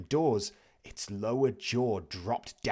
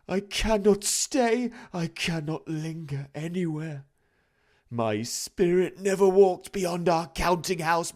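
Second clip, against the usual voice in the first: wailing ghost; 8 semitones higher, 6 dB louder than usual. A narrator's reading. The ghost lamenting its fate to Scrooge, high and wailing. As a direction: moan it like a doomed soul.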